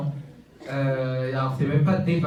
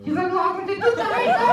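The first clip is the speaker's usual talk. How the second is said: goofy muffled sock puppet; 5 dB louder than usual. Acted. Ventriloquist's puppet heckles the guest.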